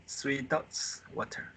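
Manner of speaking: ordinary talk, nothing marked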